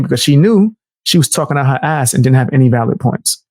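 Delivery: ordinary talk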